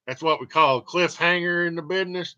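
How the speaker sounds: ordinary talk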